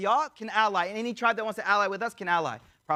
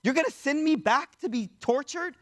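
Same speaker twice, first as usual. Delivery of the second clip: high-pitched pleading outcry, not truly shouted; imitated cry of protest; mild mock scream; 6 semitones higher than usual. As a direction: retell a scream with raised pitch only. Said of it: "He starts screaming".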